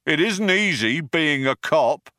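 high, distant cop voice calling out; a bored cop through a loudhailer; cop over the bullhorn. A narrator's reading. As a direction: policeman calling out from afar.

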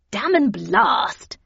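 slow, loud, drawn-out curse; emphatic, frustrated outburst; exasperated curse. A narrator's reading. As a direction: swear in sheer vexation. Havisham swears over disappointing photographs.

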